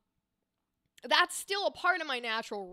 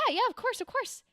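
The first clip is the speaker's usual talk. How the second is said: much higher dainty lady repeating herself; light, high, demure agreement; dainty woman; 10 semitones higher than usual. Shown as a demonstration. GM voicing a dainty woman NPC, high and light. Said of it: "a higher pitched, softer tone, daintier woman".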